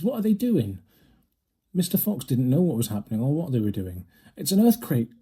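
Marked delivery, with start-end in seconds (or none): 0.0-0.8 high frightened question; small voice calling out; anxious cry. A narrator's reading. Fox cubs asking their parents, high and scared. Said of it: "cried the small foxes". 4.4-5.1 cried. A narrator's reading. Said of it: "cried Missus Fox".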